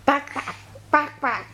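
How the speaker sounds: playful hen imitation; short, repeated bawk-bawks; chicken clucking. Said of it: "What does the chicken say".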